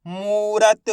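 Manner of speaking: "मूरत" with a stretched "moo"; drawn-out long vowel. Shown as a demonstration. Teacher blending letters for learners, long vowel drawn out. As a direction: hold the "oo" of "मूरत", syllable by syllable. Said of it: "खींच के पढ़ेंगे मूरत".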